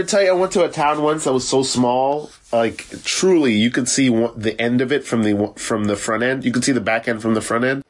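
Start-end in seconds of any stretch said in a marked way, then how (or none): none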